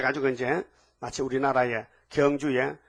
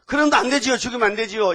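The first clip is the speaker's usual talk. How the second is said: high, loud plea for mercy; anguished pleading; 7 semitones higher, 6 dB louder than usual. Acted. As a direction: intercede urgently, as softhearted Moses. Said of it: "안타까워서".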